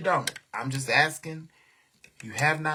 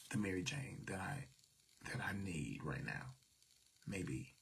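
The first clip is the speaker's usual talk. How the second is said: whispered trail-off; 7 semitones lower, 16 dB quieter than usual. Real, not acted. Trailing off about stress.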